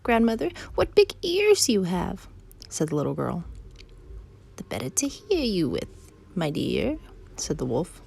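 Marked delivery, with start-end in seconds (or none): 0.0-2.3 put-on high, slow child voice; wondering little girl. Girl marvels at grandmother's ears, slow high childlike. 4.6-7.0 wolf posing as grandmother. Acted. Wolf answers the girl from bed, swooping pretence.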